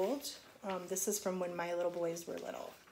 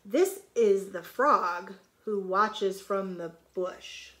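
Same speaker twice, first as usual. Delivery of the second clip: slow, louder storybook line; picture-book read-aloud; reading to children; 7 dB louder, slower than usual. Shown as a demonstration. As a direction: read each word out clearly for the kids. Reads line, then explains it.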